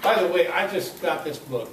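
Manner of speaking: ordinary delivery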